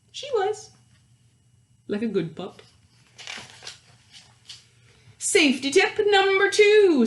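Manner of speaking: put-on lively read-aloud voice for children; animated picture-book narrator; storybook narration. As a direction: read it like story time to a class.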